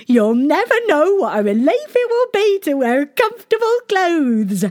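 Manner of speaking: very high gushing ladies; cartoon princess. A narrator's reading. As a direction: squeal with happy relief. Ladies relieved at new clothes.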